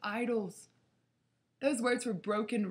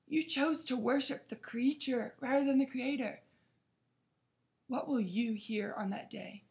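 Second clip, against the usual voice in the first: usual speaking voice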